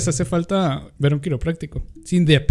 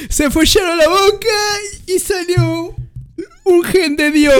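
high, tearful mock-sob voice; crying imitation; slower than usual. Quoting himself crying at the villagers kissing. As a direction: whimper it out in a high broken voice.

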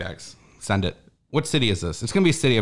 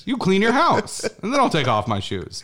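mock indignation; 7 semitones higher than usual. Ranting at host.

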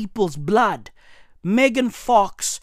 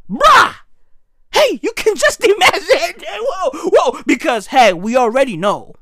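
ordinary talk, nothing marked

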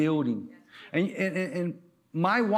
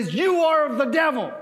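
high, raised-voice denunciation; bold accusation; 9 semitones higher, 5 dB louder than usual. Shown as a demonstration. Preacher talking back at the television, bold accusation. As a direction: accuse it loudly, pitched up. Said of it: "point at the television and say".

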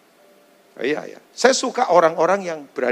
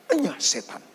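quiet rebuke; 7 dB quieter than usual. Acted. Jesus rebuking Peter, quiet and cold. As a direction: say Enyah setan under the breath, cold. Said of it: "dan berkata kepada Petrus".

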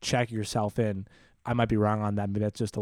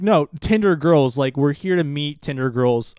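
higher, louder, slow insistence; insistent driver; 3 semitones higher, 6 dB louder, slower than usual. Retold or imitated. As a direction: spell it out to the baffled guard. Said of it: "Fish is like".

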